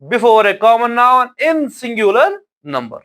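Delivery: very slow, each word separate; slow dictation. Shown as a demonstration. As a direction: dictate it so students can write. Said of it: "teacher dictating the rule". (धीरे-धीरे बोलकर लिखवाना)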